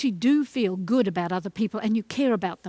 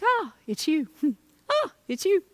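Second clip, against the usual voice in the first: familiar chuckle; 5 semitones higher than usual. Shown as a demonstration. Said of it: "you become more familiar".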